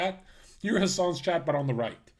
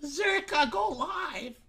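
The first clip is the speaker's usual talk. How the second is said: much higher nagging whine; whiny fan voice; 11 semitones higher, slower than usual. Retold or imitated. Mocking a needy viewer begging him to stream. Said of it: "Go watch Fouseytube".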